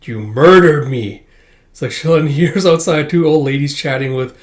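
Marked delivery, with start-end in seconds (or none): 0.0-1.2 slow, loud eerie accusing voice; haunt him with the words; ghostly accusation. Acted. Penguin Man's ghost accusing Bond, slow eerie voice. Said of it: "He's like".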